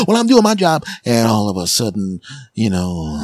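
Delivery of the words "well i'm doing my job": high, sassy comeback; snippy retort. Retold or imitated. Lady B snapping back at Lady A, high and sassy. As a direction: toss it back defensively.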